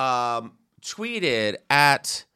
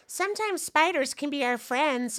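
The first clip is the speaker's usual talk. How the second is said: dreamy Luna voice; 12 semitones higher, 3 dB quieter than usual. Retold or imitated. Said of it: "The weird girl who just stares".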